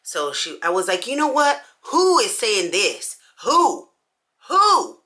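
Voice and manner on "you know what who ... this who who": fired-up confrontation; pitched-up indignant who; indignant demand. Retold or imitated.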